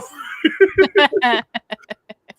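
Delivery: high loud laugh; real laughter. Real, not acted. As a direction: burst out laughing. Laughs at own story.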